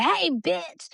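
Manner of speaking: high, punchy hey bitch; mock rough hey bitch; rough greeting. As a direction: toss it out rough and teasing. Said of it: "I might be like".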